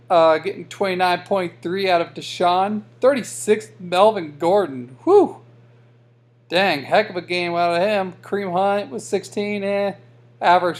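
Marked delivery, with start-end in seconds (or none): 5.0-5.4 high, loud whew; impressed whew. Real, not acted. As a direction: let out a high whew of amazement. Impressed by a big score.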